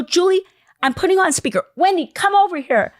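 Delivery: high, loud excited summons; acting out a frantic shout across a shop; urgent calling over. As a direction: call your sister over urgently. Urgently calling sister over to phone.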